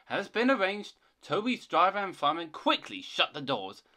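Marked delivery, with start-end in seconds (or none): none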